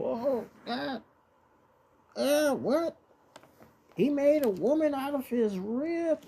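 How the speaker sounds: incredulous doubters. Acted. Preacher mimicking people who doubt the rib story, mock incredulous. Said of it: "you still got people".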